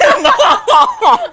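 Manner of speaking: high, burst of laughter; loud laugh. Real, not acted. Laughs at her own mistake.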